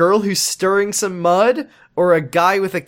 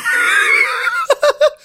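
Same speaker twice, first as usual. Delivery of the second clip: shrill laughing shout, much higher; high shrieking laugh; 4 dB louder than usual. Real, not acted. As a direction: burst into a squealing laugh. Laughing at the joke.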